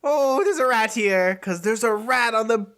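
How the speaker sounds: high fussy shop lady shrieking at a rat; Missus Sweet. Retold or imitated. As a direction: play a flustered lady in a high voice. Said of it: "Missus Sweet's like".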